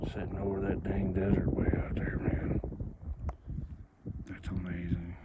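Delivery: whispered in quiet awe; soft whisper watching the desert; hushed whisper. Real, not acted. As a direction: murmur it to the open desert.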